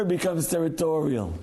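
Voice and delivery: normal talking voice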